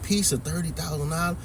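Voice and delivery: incredulous rising question, a bit hammed; lightly mocking surprise at the price; mock disbelief. Mocking buyers' shocked question.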